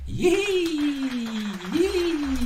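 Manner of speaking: very high squeaky yee; high cheer. Pretend. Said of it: "we're gonna be like".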